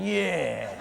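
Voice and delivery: put-on a scrawny kid posing tough; mock tough dork. Sixty-pound dork wearing the shirt.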